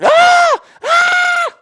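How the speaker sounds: shrill very high mock scream, loud; mock woman screaming. Mocking woman filming shark attack, very high loud mock scream. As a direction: imitate a panicking bystander screaming. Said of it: "some freak going".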